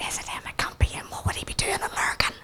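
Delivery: breathy, very soft questioning whisper; whispered disbelief. Retold or imitated. Woman spotting Matt across the room, whispering in disbelief. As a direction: whisper it to yourself, incredulous.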